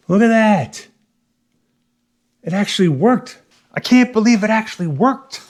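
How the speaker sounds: high-pitched, pleasantly astonished exclamation; amazed delight. Real, not acted. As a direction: marvel aloud at your own unexpected success. Amazed his repair attempt worked.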